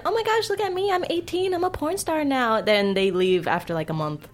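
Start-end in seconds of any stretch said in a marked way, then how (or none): 0.0-2.6 higher-pitched mock excited young starlet; giddy newcomer. Guest mocks little girls who come in, giddy high voice. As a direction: imitate a starstruck new girl.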